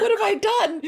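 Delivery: squealed high and loud, laughing; high laughing shriek of shock. Retold or imitated. Narrator reacting to the girl, high laughing shock. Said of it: "I'm like".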